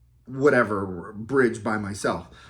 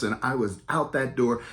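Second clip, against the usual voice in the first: normal talking voice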